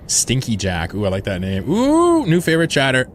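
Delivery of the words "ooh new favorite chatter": squealing high and loud; delighted squeal. Real, not acted. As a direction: shriek with delight at the name. Delighted by chatter's name.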